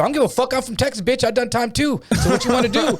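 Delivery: high-pitched, laughing fake-tough comeback; mock tough yell. Telling off a pushy DVD hustler, mock tough yell. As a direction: squeak out a defiant brush-off, half laughing. Retold threat back at hustler.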